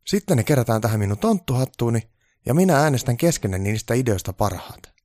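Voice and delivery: brief the team like a freshly trained little boss; keen elf giving instructions; elf foreman, organising. Acted. Voices Onni instructing the team.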